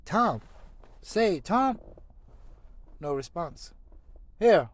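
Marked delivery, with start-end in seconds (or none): 0.0-1.8 high, loud calls of "Tom"; worried calling. Acted. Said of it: "Sid said". 4.4-4.7 very loud, high, frightened calling; alarmed shouting. Acted. Sid alarmed at groaning brother.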